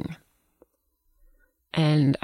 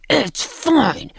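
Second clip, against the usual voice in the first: much higher, strained mock outburst; all-caps mock yell; 10 semitones higher, 3 dB louder than usual. Acting out her capital-letter text, high-pitched mock yelling. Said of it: "texts me back in capital letters".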